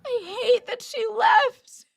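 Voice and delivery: slow, tearful, voice breaking up; choked-up real crying, slow. Real, not acted. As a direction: say it through real tears. Streamer crying over friend leaving.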